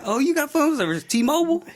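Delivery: hooking a customer in the store; pushy salesman. Retold or imitated.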